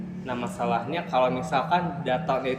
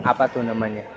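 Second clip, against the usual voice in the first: normal talking voice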